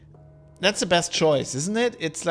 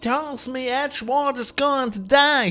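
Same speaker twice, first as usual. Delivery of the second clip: high, drawn-out mock character voice; slow, high hammed-up impression; 11 semitones higher, 5 dB louder, slower than usual. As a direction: stretch it out like a singsong politician. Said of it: "a cross between [name] and [name]".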